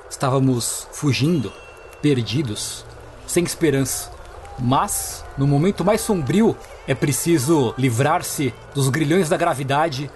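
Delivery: low, slow, solemn proclamation; grand herald voice. Acted. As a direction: announce it like a feudal lord.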